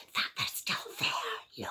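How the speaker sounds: breathless little whisper. Acted. Baby Bear whispering that the girl is still there. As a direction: hush so she won't wake.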